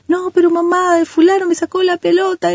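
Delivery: high-pitched mock child whine; complaining child. Child telling mom about a taken ball, mock whining. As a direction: imitate a kid protesting to mom.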